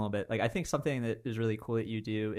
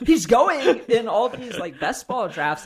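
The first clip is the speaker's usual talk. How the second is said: high, loud, slowed by laughter; laughing excitedly; 11 semitones higher, 12 dB louder, slower than usual. Real, not acted. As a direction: crow it through real laughter. Hyped fantasy pick joke cracks up.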